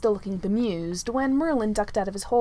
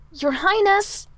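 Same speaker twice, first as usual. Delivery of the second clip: higher, loud urgent call; louder; 9 semitones higher, 7 dB louder, slower than usual. A narrator's reading.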